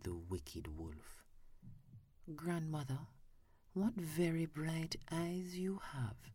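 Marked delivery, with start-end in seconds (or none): 2.3-6.3 put-on a small girl marvelling at grandmother; little girl surprised. A narrator's reading.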